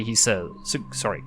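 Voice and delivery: usual speaking voice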